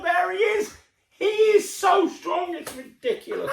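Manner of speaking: high, laughing amazement; excited. Real, not acted. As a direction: marvel at how strong he is. Marvelling at strong man.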